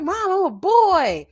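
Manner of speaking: high, slow put-on boy voice; imitating her son. Son telling mom he's a boy, imitated high and slow. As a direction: whine it like the son excusing himself. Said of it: "He be like".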